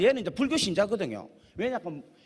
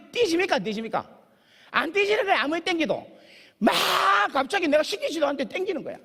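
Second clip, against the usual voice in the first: usual speaking voice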